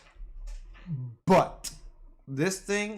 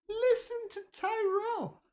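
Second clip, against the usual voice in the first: much higher, softer put-on voice; mock squeaky voice; 5 dB quieter than usual. Echoing the chat's advice to viewers, in a squeaky mock voice. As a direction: ham it up like a cartoon fan.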